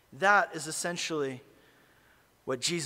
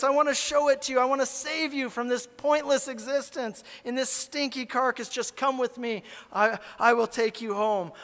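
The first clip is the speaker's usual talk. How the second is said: pleading; 8 semitones higher, 4 dB louder than usual. Pretend. Still pleading with maggots.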